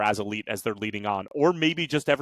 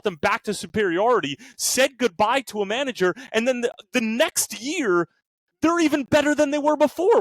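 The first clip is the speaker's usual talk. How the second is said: high-pitched, worked-up disbelief; exasperated rant; 9 semitones higher, 3 dB louder than usual. Real, not acted. Football fan ranting to co-hosts that Liverpool are overlooked, exasperated. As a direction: climb higher as the outrage builds.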